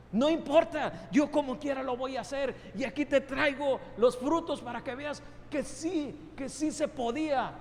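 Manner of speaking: high, loud, stubborn insistence; defiant believer. Acted. A believer defying God with his results, loud stubborn insistence. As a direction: push back, determined to prove it.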